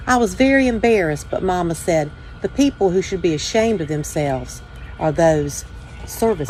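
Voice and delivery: put-on calm, steady read-aloud voice for children; story reading. A narrator's reading. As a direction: tell it gently to young listeners, like at story time. Reads children's picture book aloud.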